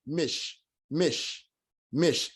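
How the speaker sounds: mish said clipped, the shiwa silent; mish, mish, closed syllable. Shown as a demonstration. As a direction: repeat mish with no vowel after the sh. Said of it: "meaning it's silent".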